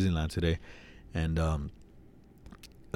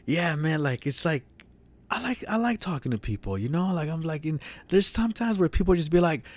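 mimicking David; 8 semitones higher than usual. David griping to friend about small talk, lightly mimicked. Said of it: "And then he was like".

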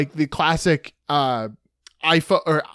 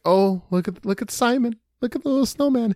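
lightly voiced girl, pitch rising higher; cooing at snowman; 5 semitones higher than usual. Retelling an ad, lightly voicing the girl's delighted coo. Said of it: "And she's like".

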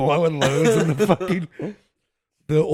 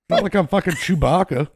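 ordinary talk, nothing marked

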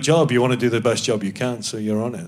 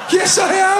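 much higher, louder laughing shout; gleeful laughing yell back at the TV; 4 dB louder than usual. Retold or imitated.

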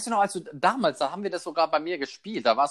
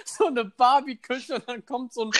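giggling through the words, higher; laughing; 11 semitones higher than usual. Real, not acted. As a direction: speak while cracking up. Laughing through a silly joke.

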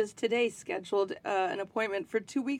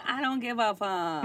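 carefree mom. Pretend. Pretend cool pool-party mom.

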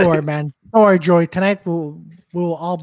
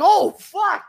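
high-pitched stunned exclamation; shocked reaction; 11 semitones higher than usual. Retold or imitated. Comic reacting to a brutal roast, shocked. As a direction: react aghast, pitch shooting up.

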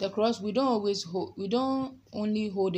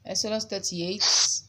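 Nigerian accent; 2 semitones higher, 6 dB louder than usual. Real, not acted. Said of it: "my Nigerian accent has come".

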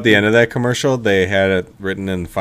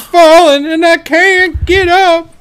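high, loud, slow wail; old lady cry; 10 dB louder, much slower than usual. Retold or imitated. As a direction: wail for help like a grandma. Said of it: "the old lady's falling".

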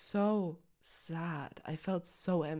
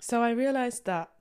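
ordinary talk

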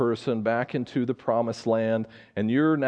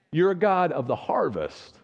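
usual speaking voice

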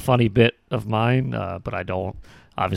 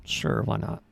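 put-on unenthusiasm. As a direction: feign a total lack of excitement. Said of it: "You don't sound excited for this".

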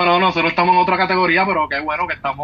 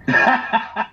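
short giggle held back; stifled giggles; 4 semitones higher than usual. Real, not acted. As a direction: giggle while trying to stay serious. Laugh during countdown before recording.

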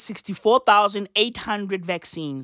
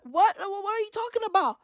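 high, baffled what; mock disbelief, pitched way up; squeaky incredulous what; 10 semitones higher, 6 dB quieter, faster than usual. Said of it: "I'm like".

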